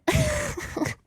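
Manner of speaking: high burst of laughter; sudden helpless giggle; laughing. Real, not acted. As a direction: crack up mid-rant. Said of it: "I started laughing at the mental image".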